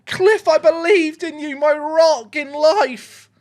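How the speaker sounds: high, drawn-out wail of disappointment; anguished lament. Real, not acted. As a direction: lament like a betrayed fan. Mock-betrayed lament after missed chance.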